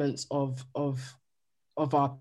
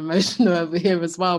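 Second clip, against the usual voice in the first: quick, a little louder, a laugh breaking in; laughing, a bit emotional; 4 semitones higher, 7 dB louder, faster than usual. Real, not acted. Guest thanks the host, laughing through emotion. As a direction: laugh off being moved.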